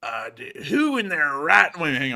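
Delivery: put-on grumpy, slow, tipsy old man; hungover old man. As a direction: grumble like a groggy old grump. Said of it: "old, grumpy, slightly drunk, slightly hungover man's voice".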